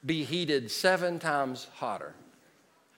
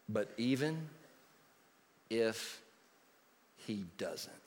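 much softer, slow and hushed; quiet reverent emphasis; 4 semitones lower, 8 dB quieter, slower than usual. Shown as a demonstration. Preacher pausing over the key words, hushed. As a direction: let each word land softly. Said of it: "These five words, change the game".

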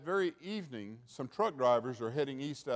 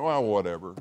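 dismissive whatever; 5 semitones lower, 5 dB louder than usual. Retold or imitated. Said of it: "we're just slacking".

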